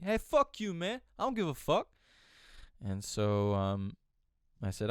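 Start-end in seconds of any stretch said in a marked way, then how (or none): 0.0-1.9 high-pitched, louder imitation of defiance; hammed-up angry dismissal; mock defiant outburst. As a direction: throw it back like he couldn't care less. Retells defiant man cursing him.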